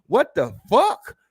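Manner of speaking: mocking a woman's hostile glare; mock indignant what. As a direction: stretch out the offended question.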